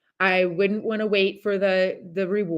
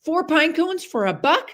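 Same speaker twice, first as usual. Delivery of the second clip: put-on kid excitement; 8 semitones higher, 4 dB louder, slower than usual. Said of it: "I'm like".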